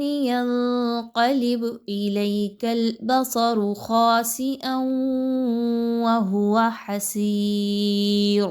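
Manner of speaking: slow, melodic tilawat of the verse; Quran recitation. Shown as a demonstration. Teacher recites the full verse once for students. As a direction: recite the whole ayah slowly and melodically. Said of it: "ایک بار اس آیت کو پڑھتے ہیں".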